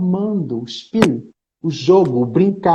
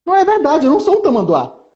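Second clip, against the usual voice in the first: surprised protest; 10 semitones higher, faster than usual. Acted. Narrator objecting to being called an anteater, surprised. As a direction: protest in startled disbelief. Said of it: "narrador surpreso e falando".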